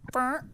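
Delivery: short, high fart noise; imitated little fart. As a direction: mimic a child's small toot. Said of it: "let out a little bit of".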